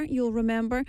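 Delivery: ordinary talk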